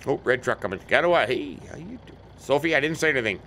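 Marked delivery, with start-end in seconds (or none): 1.3-2.2 quietly mumbled under the breath; mumbled aside. Real, not acted. Driver mumbling a greeting under his breath. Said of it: "I'm mumbling under my breath".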